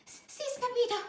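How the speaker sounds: high, breathless little dog; panting. A narrator's reading. Smallest chihuahua out of breath, high panting. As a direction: pant the words out. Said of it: "panted Poquito Tito".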